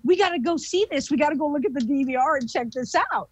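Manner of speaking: quick, high-pitched rush; urgent excitement. Retold or imitated. Investigators rushing to see the DVR, urgent. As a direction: hurry everyone along, fast and high. Acting out urgent reaction to smack.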